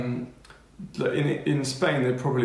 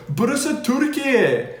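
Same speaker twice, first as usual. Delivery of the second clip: high, loud, drawn-out teacher's outcry; slow, hammed-up exclamation at top pitch; mock exasperated shout; 11 semitones higher, 7 dB louder, slower than usual. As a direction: imitate a teacher crying out in disbelief. Imitating the exasperated Turkish teacher.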